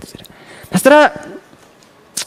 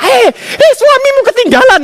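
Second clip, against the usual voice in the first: mock shout; 5 dB louder than usual. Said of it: "Masa saya teriak-teriak".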